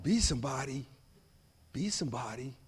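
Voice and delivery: low, soft wondering question; stunned quiet disbelief. Retold or imitated. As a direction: repeat it in quiet awe. Stunned repeating teacher's words.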